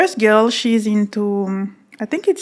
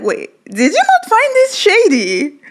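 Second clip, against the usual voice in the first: high-pitched, put-on disbelief; incredulous retold question; 12 semitones higher than usual. Guest retelling how she challenged him, high-pitched and incredulous. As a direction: query it in a much higher retelling voice.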